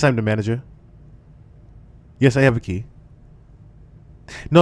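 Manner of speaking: lightly voiced manager calmly calling 911. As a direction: report a crisis like ordering takeout. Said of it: "you don't sound a bit concerned to be a hotel manager".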